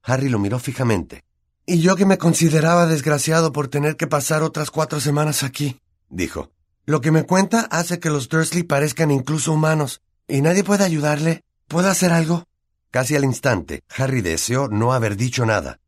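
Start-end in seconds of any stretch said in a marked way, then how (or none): 1.7-5.8 put-on high-pitched boy's voice, dismayed; dismayed young wizard. Boy pities the enslaved elf, high dismayed voice. 6.9-12.4 put-on high-pitched boy's voice, dismayed; dismayed young wizard. Boy pities the enslaved elf, high dismayed voice.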